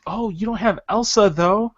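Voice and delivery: lightly voiced nagging wife; mock wife. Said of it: "she'll be like".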